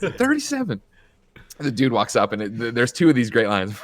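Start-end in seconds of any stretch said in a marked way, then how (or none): 0.0-0.8 much higher, mock-shrill repeat of the number; imitating the girlfriend's answer, pitched way up; hammed-up reveal. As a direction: ham it up as the punchline.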